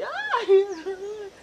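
long, loud high wail; exaggerated drawn-out sobbing; mock crying. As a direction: bawl it out in comic falsetto. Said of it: "la ve llorando".

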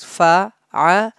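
fa'ala in three separate syllables, fa, a, la; said separately. Shown as a demonstration. Teacher showing students the letters before joining them, said separately. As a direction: leave a gap after each syllable of fa'ala. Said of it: "separately with spellings".

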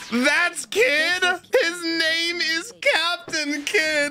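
high-pitched, stretched-out disbelief; incredulous outcry. Real, not acted. Viewer learning who Captain Kidd is, high incredulous wail.

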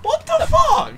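very high, loud squeal of disbelief; real, incredulous yell; shocked scream. Real, not acted. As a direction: shriek in gamer outrage. Shocked by game event.

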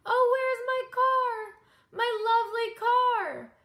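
high, loud tearful cry; a loud mock-tearful lament. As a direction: cry out for the lost car. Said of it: "She blew her nose and cried".

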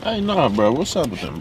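usual speaking voice

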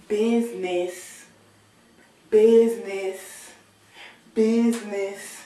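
"business" stretched slow and hit loud; emphatic "business". Pretend. Threatening the girl, stretching out "business". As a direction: drag out "business" each time. Repeating word, louder.

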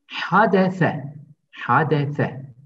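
Hadese with a throaty h and a lisped th. Shown as a demonstration. Teacher drilling Hadese for the class, lisped th. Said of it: "ince okutuyoruz".

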